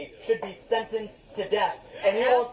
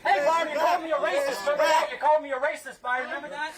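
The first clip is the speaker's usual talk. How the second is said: raised, high-pitched taunting challenge; calling out; 8 semitones higher than usual. Real, not acted. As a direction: shout a dare over a chanting crowd. Calling out an opponent through crowd noise.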